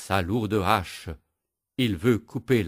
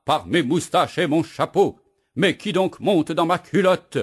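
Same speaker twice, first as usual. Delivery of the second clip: much higher, loud panicked yell; yelling and crying out; 8 semitones higher, 6 dB louder than usual. Acted. Woodcutter yells at something in his breeches. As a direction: shriek it, losing control. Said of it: "le bûcheron hurle et crie".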